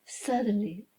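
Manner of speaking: ordinary delivery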